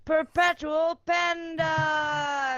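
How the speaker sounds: drawn-out ring announcer; announcer intro. Acted. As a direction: build each name up slowly. Wrestling-style player introduction.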